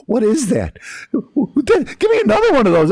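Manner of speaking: high, loud, laughing amazement; amazed first taste. Retold or imitated. Acts first taste reaction, laughing.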